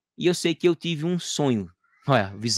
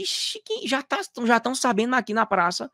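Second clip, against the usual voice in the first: high, muted mock exasperation; rising irritation; 9 semitones higher than usual. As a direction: imitate a sudden flare of annoyance, high-pitched. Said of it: "Aí subiu aquela raiva dizendo assim".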